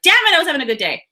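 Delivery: mock annoyed rush of words; annoyed outburst. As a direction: blurt it out fast, mildly pissed off. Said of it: "you're pissed, like".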